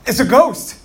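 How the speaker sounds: sudden high, loud shout of fright; frightened cry. A narrator's reading. Disciples on the boat crying out in fright at the ghost. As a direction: cry out in terror at the apparition. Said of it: "they cry out".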